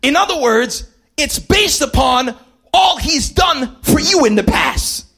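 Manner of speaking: full-force gospel preaching. Acted. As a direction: preach it with rising fire.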